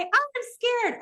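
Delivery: nervous seatmate answering, high and small; scared old lady. Retold or imitated. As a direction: play the trembling passenger. Old lady replies on plane.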